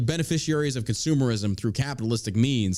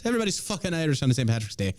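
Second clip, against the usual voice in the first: normal talking voice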